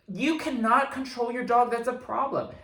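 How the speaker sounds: high, loud, stretched-out indignant scolding; retold reprimand. Dog owner lecturing a stranger, retold reprimand. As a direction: lay down the law, re-enacted.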